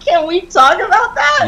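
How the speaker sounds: said through a real laugh, high. Real, not acted. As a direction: laugh the question out. Laughing after rattlesnake joke.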